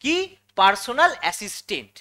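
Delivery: usual speaking voice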